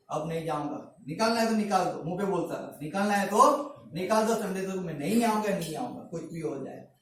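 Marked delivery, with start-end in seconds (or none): 0.1-2.0 retold defiance. Retells own defiance to employer. 2.8-6.9 retold refusal. Retells own refusal to work Sundays.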